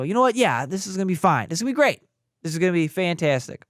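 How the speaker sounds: high, quick mock pep talk; forced optimism. Panicked father reassuring himself about triplets, forced cheer. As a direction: talk yourself into being thrilled.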